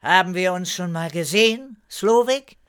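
high voice climbing to the name; questioning call. A narrator's reading. Erika calls back to the hay wagon, questioning. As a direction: call the question over, curious. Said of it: "rief fragend".

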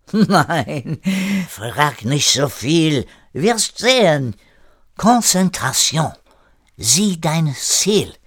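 put-on high, slow, emphatic French accent; commanding French-accented René. René telling Erik to concentrate, emphatic French accent. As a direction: order him to focus, in a French accent.

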